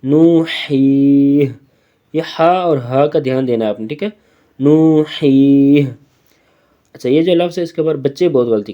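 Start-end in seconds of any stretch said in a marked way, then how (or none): 0.0-1.5 نو، ہی، drawn out slowly in Quran recitation style; waqf stop reading. Shown as a demonstration. Teacher shows pupils the waqf reading, slow and drawn. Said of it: "وقفاً". 4.6-5.8 نو، ہی، drawn out slowly in Quran recitation style; waqf stop reading. Shown as a demonstration. Teacher shows pupils the waqf reading, slow and drawn. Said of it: "وقفاً".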